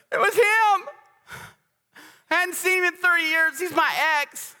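mimicking a self-pitying excuse-maker; mock excuse whine. Mimicking excuse-making congregant.

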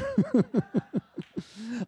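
high, chuckling laugh; tickled by your own joke. Real, not acted.

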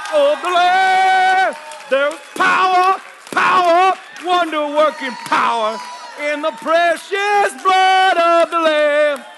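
loud, belted hymn, ruining the song; like you mean it. Shown as a demonstration. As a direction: shout the hymn with full force. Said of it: "Say it like you mean it".

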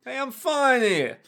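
loud, high hammed-up thug; mock mook voice. Mook showing off his burning glove, in a hammed-up voice. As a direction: play a showy street mook.

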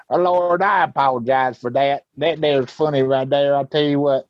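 country comic impression, playful; lightly mocked country comedian voice; Larry the Cable Guy. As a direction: imitate a folksy stand-up comic's catchphrases. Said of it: "Larry the Cable Guy".

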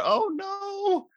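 very high, put-on lament; hammed-up high-pitched dismay; mock dismay. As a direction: wail it in exaggerated fake shock. Mock dismay at cancer plot twist.

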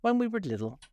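high, plaintive opening, a touch tearful; a little sobbing. A narrator's reading. Mock Turtle resuming his childhood story, sobbing a little. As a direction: start high, with a mild hint of a sob. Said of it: "still sobbing a little".

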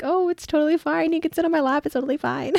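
high-pitched mock nonchalance; retold too-casual reassurance; overdone reassurance. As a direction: insist on it in a high, lilting voice. Acted over-eager reassurance from book.

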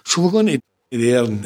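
normal talking voice

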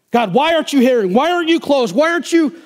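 high, rising cry at God; demanding complaint to God. Acted. Believer complains to a silent God, insistent and high.

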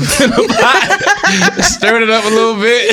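high, drawn-out words through laughter; laughing too hard to talk straight. Real, not acted. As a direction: crack up while telling it. Laughing over own stove story.

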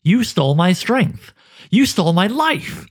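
high, raw accusing cry; roaring fury. Acted. Anduin accuses the Hooded One of stealing everything, furious high cry. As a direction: hurl it at your tormentor. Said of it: "roaring fury crushed all tact".

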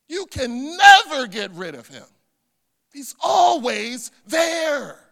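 loud, emphatic proclamation, key words stretched; emphatic preaching. Real, not acted. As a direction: proclaim it with force, pitch swooping up. Preacher proclaiming Jesus's constant presence.

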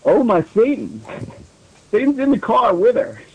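high, hammed-up gush; overacted fangirl excitement; mock fangirl squeal.

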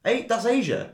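high-pitched arguing back; indignant retort. Real, not acted. Co-host standing his ground about Asia, indignant. Banter dispute over where Maidan is.

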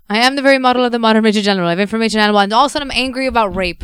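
loud sound-check rant. Shown as a demonstration. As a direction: belt it out for the mic check. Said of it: "Just keep talking".

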